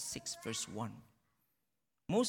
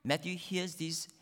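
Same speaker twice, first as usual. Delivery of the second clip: ordinary talk, nothing marked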